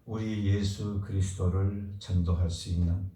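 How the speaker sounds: ordinary delivery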